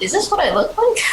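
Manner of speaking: high, amused character question; laughing high voice. Retold or imitated. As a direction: ask it laughing in disbelief. Character stares in mirror.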